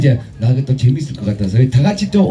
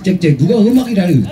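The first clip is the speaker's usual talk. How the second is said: ordinary talk, nothing marked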